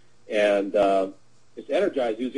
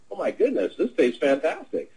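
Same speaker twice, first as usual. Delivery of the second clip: delighted amazement; 7 semitones higher than usual. Retold or imitated. Passenger delighted by her coffee.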